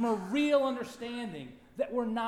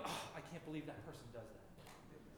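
muttered annoyance; 5 semitones lower, 20 dB quieter than usual. Retold or imitated. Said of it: "Do you look at them and go".

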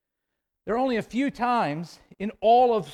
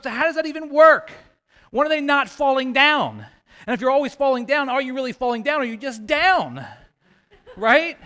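loud, high, baffled questioning; worked-up preacher laughing at the puzzle; amused incredulity; 5 semitones higher, 7 dB louder than usual. Real, not acted. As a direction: fire off questions, breaking into a laugh.

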